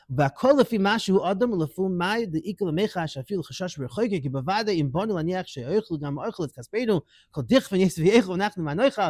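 racing through the Hebrew text at speed; fast study-hall reading of a text; rapid text reading. Acted. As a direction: read the source quickly in a learning singsong.

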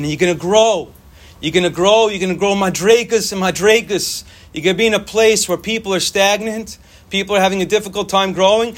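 normal talking voice

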